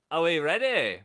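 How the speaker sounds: playful Scottish call to the audience; loud mock-Scottish greeting; Scottish accent. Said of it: "I don't know why I'm always Scottish".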